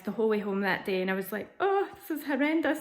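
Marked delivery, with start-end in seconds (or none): 1.6-2.8 high, wailed groan of dismay; retold dismay. Patient going home after appointment, retold wailing dismay. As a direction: lift it into a mock wail. Acts crying her way home.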